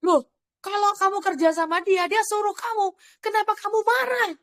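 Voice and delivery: high-pitched retold remonstrance; puzzled challenge. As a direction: question them in mock disbelief, pitched high. (menegur heran dengan nada tinggi)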